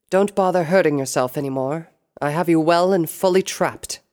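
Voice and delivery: regal tone. A narrator's reading. Princess Luna to her trapped captive, regal. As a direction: declare it like a ruling princess. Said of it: "the regal tone made it easy to tell".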